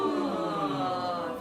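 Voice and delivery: high voiced yawn sliding down; yawn sigh. Shown as a demonstration. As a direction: yawn out a long sighing glide. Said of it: "One more time, maybe higher".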